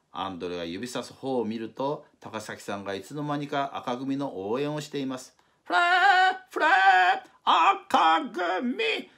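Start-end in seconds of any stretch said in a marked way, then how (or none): 5.6-9.1 high, loud cheerleader chant; cheering. Acted. Takasaki cheering on the red team, a loud high chant. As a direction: belt it out like a sports-day cheer.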